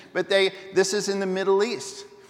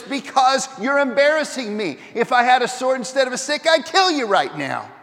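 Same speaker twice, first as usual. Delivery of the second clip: raised, louder angry retort; higher, louder furious master; angry Balaam; 5 semitones higher, 5 dB louder than usual. Acted. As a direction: snap back at the donkey in a temper. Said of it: "He's mad".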